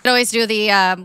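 ordinary delivery

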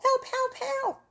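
high panicked cries for help; shouting for help. Acted. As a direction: squeal help help help like a terrified girl. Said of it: "runs off shouting".

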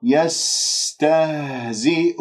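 slow chanted يستهزئ with a long hissing s; flowing seen. Shown as a demonstration. Teacher models seen and haa, slow elongated recitation. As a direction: let breath and sound run through the s of يستهزئ. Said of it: "سين يجري فيها الصوت".

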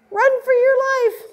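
high, frantic squeal of alarm; panicked cry. Acted. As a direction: shriek it like a scared little critter. Prairie dogs flee in panic.

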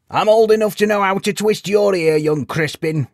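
high, loud mock threat; playful old codger; teasing old man. A narrator's reading. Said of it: "same smile and a wink".

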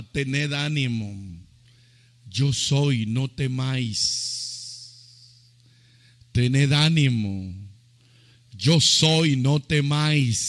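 low, resounding proclamation; Jesus reassuring the disciples. Retold or imitated. Preacher repeats Jesus' words so the church will praise, low and solemn. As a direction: declare each phrase firmly, pausing between them. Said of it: "Se lo repito para ver si usted alaba".

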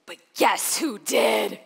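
loud, slow bitter shout; livid. Retold or imitated. Venting to her husband, a bitter slow shout. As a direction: hurl it out in bitter rage. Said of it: "I was angry, and I was livid".

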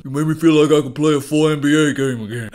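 hammed-up celebrity gratitude, only a hint of the voice; Shaq impression. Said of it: "I can do a really good Shaq impression".

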